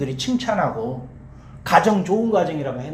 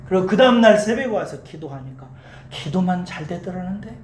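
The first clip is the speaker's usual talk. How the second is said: ordinary talk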